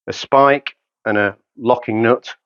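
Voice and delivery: ordinary talk, nothing marked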